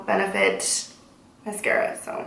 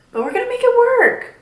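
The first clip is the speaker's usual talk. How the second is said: pitched-up upbeat determination; high cheerful push; 14 semitones higher, 5 dB louder than usual. Real, not acted. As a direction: squeak it out with bright resolve. Upbeat pep after no-show.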